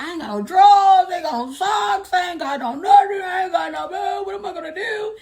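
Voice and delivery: high, loud hammed-up whining; mocking the ex's whiny complaints. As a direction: wail like a pathetic boyfriend. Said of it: "He's like".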